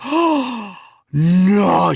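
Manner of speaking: high, loud, appalled Scottish lady; Missus Butler. Retold or imitated. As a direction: wail it in mock shock as a Scottish mum. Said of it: "tiny little Missus Doubtfire, basically".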